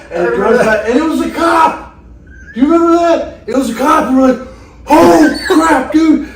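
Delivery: high, loud excited storytelling; laughing shout about the cop. Real, not acted. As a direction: tell it at full volume, thrilled. Excited retelling of cop scare.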